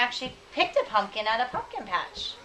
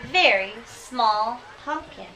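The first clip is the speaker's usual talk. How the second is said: drawn-out storytelling; much slower than usual. Acted. Teacher reading, drawing out tiny pumpkin.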